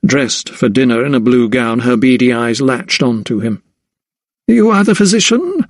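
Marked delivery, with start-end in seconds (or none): 4.5-5.6 high-pitched haughty lady; imperious lady's question in a high voice. A narrator's reading. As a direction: ask it down your nose, pitched right up. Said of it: "she asked haughtily".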